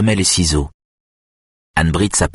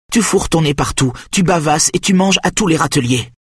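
reproches furieux; 9 semitones higher than usual. A narrator's reading. Mother's angry accusations at the boy.

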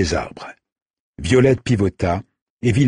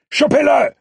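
high, savage yell; shouted order; 5 dB louder than usual. Acted. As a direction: scream the command to charge. Leader orders the charge.

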